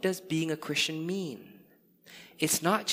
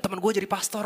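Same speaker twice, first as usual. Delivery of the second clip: higher, excited retelling; excited friends; 6 semitones higher, much faster than usual. Retold or imitated. Friends thrilled that he is a pastor, imitated excitement. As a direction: imitate friends proudly announcing it. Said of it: "really excited".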